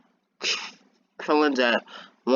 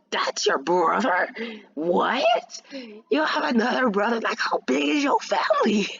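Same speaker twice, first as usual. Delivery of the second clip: breathy, rising, higher-pitched disbelief; shocked disbelief; 8 semitones higher than usual. Acted. Friends learning of another brother, shocked disbelief. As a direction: draw out the shock, then climb higher. Acts everybody shocked at the news.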